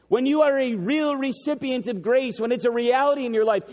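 normal talking voice

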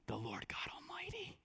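barely audible awed whisper; hushed, breath-only reverence; reverent whisper. Acted. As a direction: whisper the holy name. Quoting Isaac's blessing reverently.

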